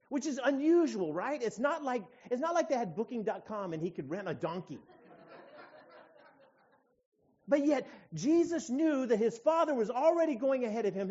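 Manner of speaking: loud, high-pitched sermon delivery; forceful preaching. Real, not acted. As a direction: preach it out with conviction.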